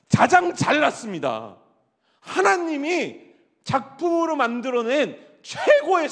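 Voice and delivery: loud, slow proclamation; slow climactic proclamation. Acted. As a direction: drive each word home slowly.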